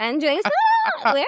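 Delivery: high, slow, searching for the hair; drawn out while picking at the mouth. Real, not acted. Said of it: "I have a hair in my mouth".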